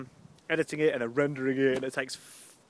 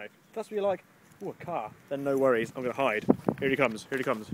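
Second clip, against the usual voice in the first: ordinary talk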